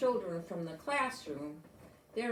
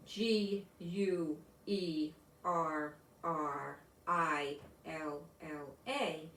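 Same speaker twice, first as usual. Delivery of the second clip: slow, lower, letter by letter; spelling out letters; 4 semitones lower, much slower than usual. Acted. Teacher spells the word on the board for her class, slow and deliberate. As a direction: sound out each letter slowly for the class.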